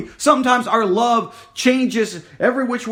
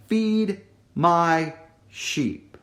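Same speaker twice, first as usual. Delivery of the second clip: slow gentle command; 3 semitones lower, much slower than usual. Acted. Jesus commands, slowly.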